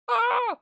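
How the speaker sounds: sharp high mock shriek; imitating villain cry. Viewer copying the Mad King's laugh, high mock shriek. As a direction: screech it like the cackling Mad King. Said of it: "That's what it sounded like".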